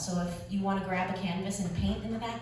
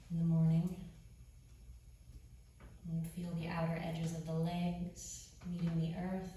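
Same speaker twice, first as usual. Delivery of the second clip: guided meditation; 3 semitones lower, 10 dB quieter than usual. Acted. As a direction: ease them into rest, softly. Leads quiet guided meditation.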